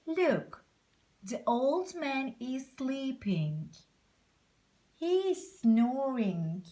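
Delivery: plain reading voice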